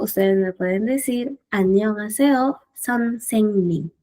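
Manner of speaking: normal talking voice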